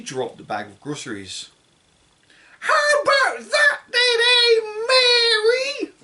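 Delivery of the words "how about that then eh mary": put-on high, loud screeching old woman; cranky grandma yelling from the roof; shouting grandma. A narrator's reading. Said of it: "Grandma shouted".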